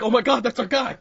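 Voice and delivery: loud, high, delighted cheer; mock excited cheer. Friends pretending to watch their mate on TV, loud cheer. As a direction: cheer your friend on like a fan. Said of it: "We'll be like".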